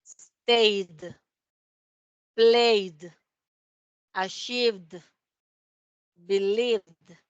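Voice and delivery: Stayed, Played, Achieved, Believed said with a silent e and a final "d"; model pronunciation. Shown as a demonstration. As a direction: model each word for the class, the e silent. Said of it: "vamos a pronunciar como si la E no existiera". (cada palabra con la e muda y final en "d")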